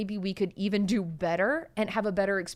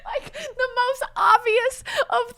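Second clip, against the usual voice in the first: high laughing voice; giggling through the words; 12 semitones higher, 6 dB louder than usual. Real, not acted. Laughing at herself.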